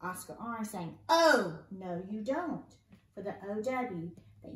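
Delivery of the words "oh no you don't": acted shout easing into drawn-out words; playful yell. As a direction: yell it as a scolding storybook character.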